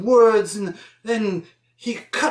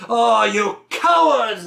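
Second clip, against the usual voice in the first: slow, higher-pitched theatrical scolding; scornful servant; 4 semitones higher, 4 dB louder, slower than usual. Acted. Servant berates three men who refused to drink, hammed-up scorn. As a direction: draw it out with hammy contempt.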